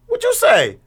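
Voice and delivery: very high, incredulous demand; mock-shocked dad, voice shooting up; retold parental challenge. Dad imitating himself catching son cussing, incredulous.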